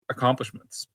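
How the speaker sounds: usual speaking voice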